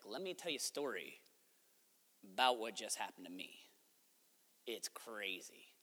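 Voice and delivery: very soft, low, secretive delivery; hushed storytelling. Acted. Peter telling friends inside the house, hushed. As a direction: lean in and share it in near-whisper.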